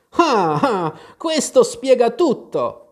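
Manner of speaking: high, loud, drawn-out "ah, ah"; triumphant realisation. A narrator's reading. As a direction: crow that it all makes sense. Hatter triumphantly seizes on Alice's admission. (scoperta trionfante)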